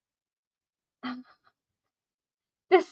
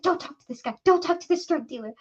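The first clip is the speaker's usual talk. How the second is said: hushed, higher, urgent mock warning; imitating freaked-out cousin; 5 semitones higher than usual. Cousin warning driver off a drug dealer, hushed mock freak-out. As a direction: warn under your breath, half whispered at the end. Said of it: "my cousin is freaking out".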